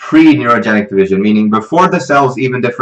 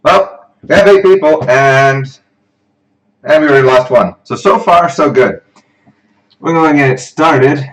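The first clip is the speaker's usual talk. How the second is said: louder, higher hosting voice; livestream presenter; 6 semitones higher, 7 dB louder than usual. Real, not acted. Tutor kicks off a session for online viewers, projected. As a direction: project brightly to open the session.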